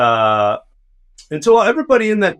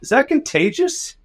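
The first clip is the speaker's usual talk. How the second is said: high, squeamish disbelieving ask; high-pitched grossed-out worry; alarmed question; 12 semitones higher than usual. Retold or imitated. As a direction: recoil at the sight of the boil. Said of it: "And I'm like".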